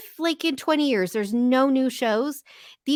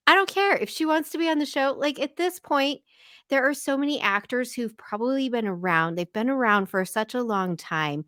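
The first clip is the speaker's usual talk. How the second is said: ordinary talk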